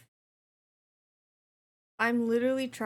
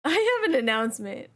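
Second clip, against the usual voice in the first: louder, laughing grand announcement; 3 semitones higher, 8 dB louder than usual. Real, not acted. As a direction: play it as a big reveal through laughter.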